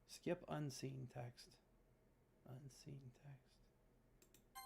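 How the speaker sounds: very soft, slow murmur trailing off; fading mutter. Real, not acted. Player murmuring about the skip button. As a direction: murmur it to yourself.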